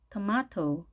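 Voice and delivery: British tomato, tuh-MAH-toh; British style. Shown as a demonstration. Teacher repeating both options, British tomato as tuh-MAH-toh. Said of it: "T 발음을 그대로 살려서".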